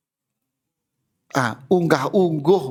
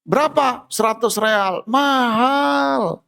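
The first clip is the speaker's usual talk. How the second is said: higher, quick back-and-forth bargaining; haggling dialogue; 8 semitones higher than usual. Retold or imitated. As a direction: play both shopper and seller lightly. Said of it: "nawarnya sebentar". (tawar-menawar dengan suara tinggi)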